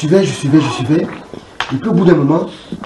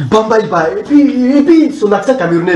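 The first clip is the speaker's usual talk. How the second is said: normal talking voice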